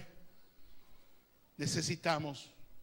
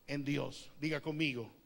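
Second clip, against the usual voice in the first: ordinary talk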